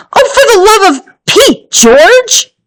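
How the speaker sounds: high, fast exasperated cry; exasperated shout. A narrator's reading. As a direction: snap at him in frustration. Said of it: "shouted her mother".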